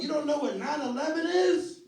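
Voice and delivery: high, loud disbelieving question; incredulous outburst. Retold or imitated. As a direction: act indignant disbelief at a child's ignorance. Annoyed uncle, retold incredulous.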